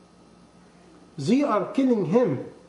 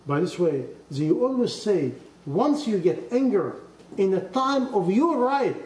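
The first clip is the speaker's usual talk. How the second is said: normal talking voice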